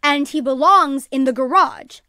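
loud, emphatic parental order; firm. A narrator's reading. Mother sends the snake out of the house, firmly. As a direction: lay down the rule, slowing at the end. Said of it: "said firmly".

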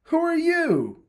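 very high, indignant squawk; acted high-pitched alarm; startled demand. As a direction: challenge the stranger in a high voice. Squashed Body confronts strangers.

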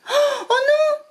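high alarmed oh no; alarmed cry. Acted. Witch spotting smoke from her broom, alarmed cry. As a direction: squeal in sudden panic. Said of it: "dit-elle".

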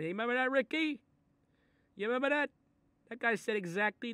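high, loud, gleeful; excited. Acted. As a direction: reminisce with glee. Excited calling to Ricky.

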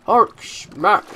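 punchy, joking brute impression; lightly voiced comic-book brute; Hulk. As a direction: do your best mock monster roar in two words. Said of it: "That's my best Hulk impression".

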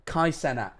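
normal talking voice

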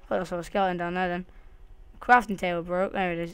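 ordinary talk, nothing marked

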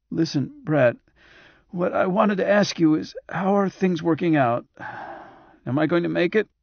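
high, reedy patient voice; Roger the patient, anxious question. Acted. Patient asks doctor if he will make it, high reedy voice.

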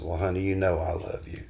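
tender reassurance. Retold or imitated. Husband finally tells wife tender.

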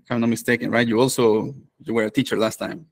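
ordinary delivery

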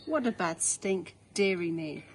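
ordinary delivery, nothing marked

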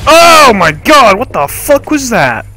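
startled high yelp into swearing; jump-scared gamer shrieking; raging gamer. Real, not acted.

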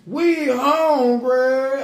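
drawn-out "We hungry", "We" held long and slow; slow hungry plea. Retold or imitated. Retelling the barbershop boys, slow drawn-out plea. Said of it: "They talking about".

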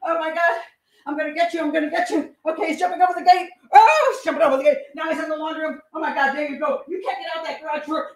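high-pitched, urgent play-acted pursuit; hammed-up attacker in hot pursuit; frantic chase re-enactment. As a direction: act out a wild chase, crying out in bursts. Acting out attacker chasing fleeing victim.

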